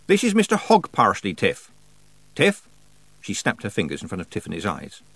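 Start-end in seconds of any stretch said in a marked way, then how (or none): 0.1-2.7 somewhat louder, high-pitched introduction; raised voice. A narrator's reading. Nanny introduces old man to a dazed girl, voice raised. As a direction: speak up to get the girl's attention. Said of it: "raising her voice".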